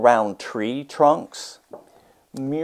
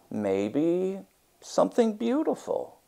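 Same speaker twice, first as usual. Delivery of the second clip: soft reply; 9 semitones higher, 5 dB quieter than usual. Acted. Muralist replies to Myra.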